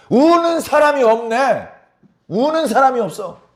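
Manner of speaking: high, slow, loud cry of dismay, nobody weeping; angel's lament. Acted. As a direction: wail it like a searching angel. Angel finds no one weeping. (높고 느리게 탄식하는 천사의 목소리)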